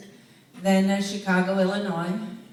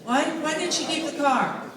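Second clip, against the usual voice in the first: raised high and loud to be heard over the room; calling out; 9 semitones higher, 5 dB louder than usual. Real, not acted. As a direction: call the question out over the chatter. Calling over room's neighbor chatter.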